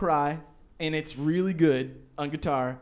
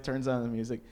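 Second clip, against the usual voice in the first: ordinary talk, nothing marked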